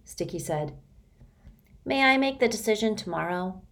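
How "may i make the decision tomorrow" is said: mumbled into his knees, higher; a shy boy's muffled plea; muffled mumble. Acted. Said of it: "His voice muffled, his words mumbled".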